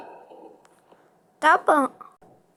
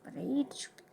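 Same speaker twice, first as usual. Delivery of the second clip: whispering; 14 dB quieter, much faster than usual. Acted. Aside while grabbing money.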